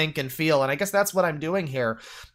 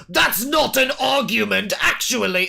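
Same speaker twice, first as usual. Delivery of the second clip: mock pompous objection; 6 semitones higher than usual. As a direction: ham up a smug debater correcting someone. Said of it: "him acting, like, all".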